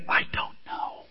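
soft whisper, breath only; whispered admission. Real, not acted. Pastor admitting to the congregation, whispered. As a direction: confess it in a hushed whisper. Admits not understanding the mystery.

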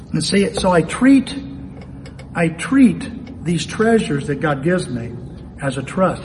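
ordinary talk, nothing marked

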